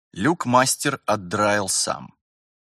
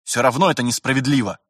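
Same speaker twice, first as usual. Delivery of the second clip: raised, louder indignant outburst; blurted protest; 4 semitones higher, 6 dB louder than usual. A narrator's reading. Vitaly breaks his suffering silence, blurting a protest. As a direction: blurt it out, unable to hold back. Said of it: "Потом не выдержал и выпалил".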